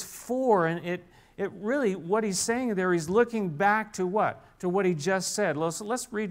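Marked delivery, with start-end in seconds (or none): 0.0-0.7 "for" lifted high on its own; stressed word. Shown as a demonstration. Pastor stressing the verse's first word, "for" set high. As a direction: isolate "for" with a high lift. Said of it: "the first word is".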